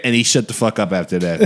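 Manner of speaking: ordinary talk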